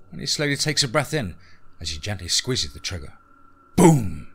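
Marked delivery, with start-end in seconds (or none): 3.8-4.3 loud, drawn-out boom; sudden loud burst; booming exclamation. A narrator's reading. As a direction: punch it out like a gunshot. Narrator voices the gunshot.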